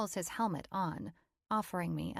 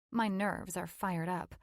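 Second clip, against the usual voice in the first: usual speaking voice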